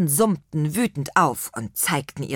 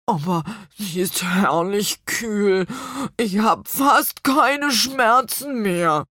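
langsam, zum Ende höher und lauter; erleichtertes Apfelmännchen; 4 semitones higher, slower than usual. Acted. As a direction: aufatmend strahlen. Rübenbach strahlt. (Rübenbach freut sich erleichtert über die Salbe)

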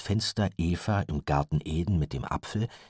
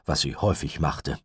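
usual speaking voice

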